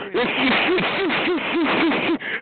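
high hammed-up mocking cackle; imitating belly laugh. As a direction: cackle like his big belly is about to fall off. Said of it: "laughing like your big belly is gonna fall off".

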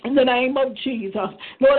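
ordinary talk

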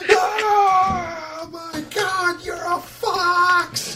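very high, drawn-out shriek; piercing scream of discovery; terrified boy's scream. Acted. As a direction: scream it in falsetto panic. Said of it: "you hear Arlo go".

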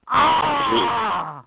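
hammed-up pleasure shout; mock ecstatic cry. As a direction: cry out in fake bliss. Climax cry.

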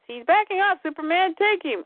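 high, taunting Lex voice. Acted. The villain taunting Superman, high and mocking. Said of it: "Lex says".

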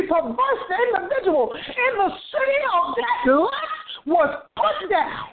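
impassioned preaching. Real, not acted. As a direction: build the list like a fervent preacher, hammering each phrase. Preaches at full force.

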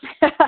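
short, high laugh; real burst of laughter, loud and high. Real, not acted. Laughs at own stern quote.

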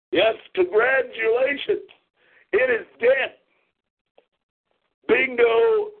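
very high, strained-up proclamation; theatrical high-pitched tirade; ranting outburst. Real, not acted. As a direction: announce the death in soaring, mocking outrage. Rants that the show is dead.

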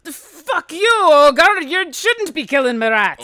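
loud, high outraged dwarf; furious gruff dwarf. Acted. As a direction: curse them out in a dwarf's roar. Dwarf in acted quarrel.

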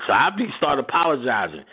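ordinary talk

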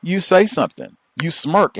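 high, loud mock officer; imitating officer. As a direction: rattle it off like a cynical cop.

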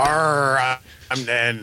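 a gruff pirate "arr"; pirate growl. Acted. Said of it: "I was a pirate".

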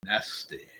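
a breathy mock-disgusted whisper; whispered nasty. Vlogger whispering nasty to his viewers. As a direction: hush it like a naughty secret.

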